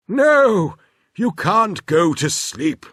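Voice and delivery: put-on busy inventor brushing the children off; Professor Tangle voice.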